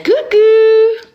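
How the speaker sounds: high, loud sing-song coucou; squealed greeting. Real, not acted. Streamer greeting viewers on her live, squealed high. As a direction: squeal hello to the fans. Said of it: "Coucou des jumeaux de Cavalière".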